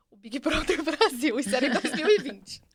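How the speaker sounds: laughing through the words. Real, not acted. Telling a friend about the vision, laughing. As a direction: giggle as you tell it.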